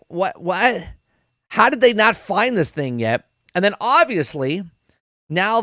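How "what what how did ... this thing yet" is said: higher-pitched baffled reaction; retold disbelief. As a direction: act out being incredulous. Acting out his own disbelief.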